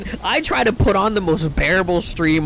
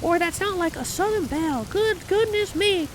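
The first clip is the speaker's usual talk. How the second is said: falsetto Southern belle impression; exaggerated high Southern belle, slowing on the exclamations; high-pitched girl's voice; 4 dB quieter than usual. Shown as a demonstration. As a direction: put on a squeaky-high lady voice. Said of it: "I can't do a girl's voice that's not high-pitched".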